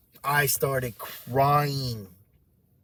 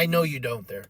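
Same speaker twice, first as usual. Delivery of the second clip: ordinary talk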